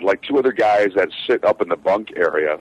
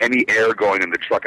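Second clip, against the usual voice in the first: normal talking voice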